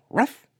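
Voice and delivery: a sharp yappy little woof; high dog bark. Acted. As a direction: bark like an excited small dog. Dog barks after speaking.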